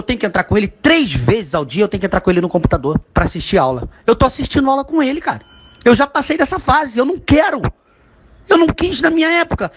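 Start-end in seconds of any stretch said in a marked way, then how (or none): 5.8-9.7 exasperated protest. Real, not acted. Exasperated father venting about online school.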